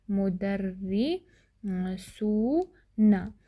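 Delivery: very slow, broken into मु-दर्रि-सू-ना; syllable by syllable. Shown as a demonstration. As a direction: sound out मुदर्रिसूना slowly, drawing out सू. Teacher sounds out the new plural. (मुदर्रिसूना को तोड़-तोड़ कर, बहुत धीरे)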